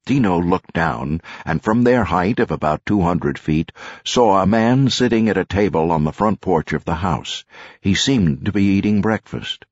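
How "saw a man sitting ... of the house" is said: ordinary delivery, nothing marked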